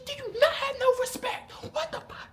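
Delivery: very high-pitched mimicry of an irate drill sergeant; ranting drill sergeant. Drill sergeant scolds trainee for disrespect, shrieky and going crazy. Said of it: "she just going crazy".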